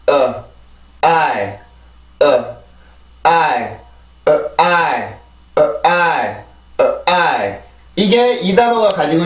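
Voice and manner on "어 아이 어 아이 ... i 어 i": 어 weak and 아이 strong beat; vowel rhythm drill. Shown as a demonstration. Teacher drilling the vowel rhythm of the place name. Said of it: "리듬 만듭니다".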